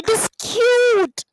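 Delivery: high, doting coo at a cute animal; cooing over a pet. Acted. Teacher playing someone who sees a cute pet, doting coo.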